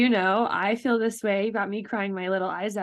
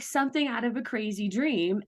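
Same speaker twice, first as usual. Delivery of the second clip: normal talking voice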